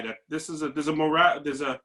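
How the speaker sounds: ordinary talk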